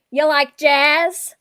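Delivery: loud, drawn-out yell at a bee; yelled catchphrase. Acted. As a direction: yell it out slowly, like a movie quote. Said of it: "I just yell out".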